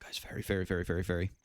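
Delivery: hushed whisper to the chat. Real, not acted. Streamer whispering to viewers mid-game, unvoiced whisper. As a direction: breathe the words, unvoiced.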